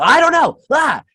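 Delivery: high, loud wild shriek; imitating a high, loud feral yell. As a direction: scream like a man out of his mind. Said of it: "he's like".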